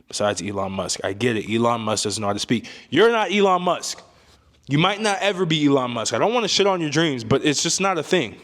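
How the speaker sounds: ordinary talk, nothing marked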